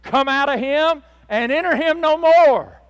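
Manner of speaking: high, loud exorcism command; preacher's shouted rebuke. Acted. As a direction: order the spirit out at full force. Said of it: "he rebuked that unclean spirit".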